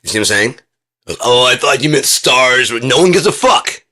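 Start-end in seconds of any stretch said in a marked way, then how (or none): 1.2-2.7 high, loud mocking quote; exaggerated imitation of a clueless objection; mock misunderstanding. Acted quote of someone who misunderstood. 2.7-3.8 high, loud indignant retort; shouted dismissal; exasperated outburst. Acted. Exasperated retort to the acted quote.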